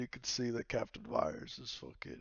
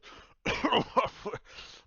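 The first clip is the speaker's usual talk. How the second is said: spluttering, choked cough; rough mock coughing burst; acted coughing; 14 semitones higher, 8 dB louder than usual. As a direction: splutter out a bad swig. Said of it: "kinda coughs against the table".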